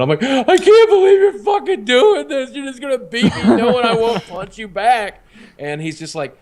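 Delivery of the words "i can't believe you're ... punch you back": imitating bawling. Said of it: "I just started bawling my eyes out".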